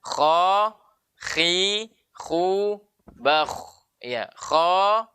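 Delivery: KHA, KHI, KHU, BAKH with a raspy back-of-throat kh, held long; contoh makhraj kha. Shown as a demonstration. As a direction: demonstrate kha, khi, khu, bakh slowly, breath flowing on kh. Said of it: "jadi kita membaca kha". (melafalkan kha, khi, khu, bakh dengan napas mengalir)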